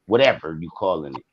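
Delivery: muttered shrug-off; dismissive mutter. Real, not acted.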